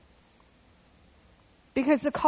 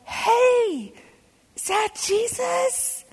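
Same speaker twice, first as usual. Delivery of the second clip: much higher, hopeful call across the water; Peter calling out; 9 semitones higher than usual. Retold or imitated. Peter in the boat calling to Jesus, high and pleading. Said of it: "Peter's like".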